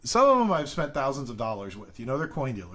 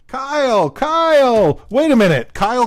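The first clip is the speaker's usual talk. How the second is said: excited shout; 11 semitones higher, 15 dB louder than usual. Real, not acted. Excited viewer recognition on stream.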